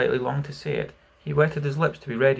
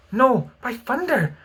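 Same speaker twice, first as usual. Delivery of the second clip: high, slow loud refusal; defiant cry; 12 semitones higher, 5 dB louder, slower than usual. A narrator's reading. Starkey refuses to enter the cabin, high defiant cry. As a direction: throw the refusal back hard. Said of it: "Starkey cried".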